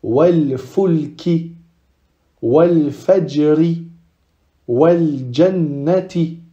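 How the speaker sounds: slow, drawn-out wal with each word; lam pronounced. Shown as a demonstration. Teacher reading lunar examples aloud, lam pronounced. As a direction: sound the L of wal in every example. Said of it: "on prononce le lam".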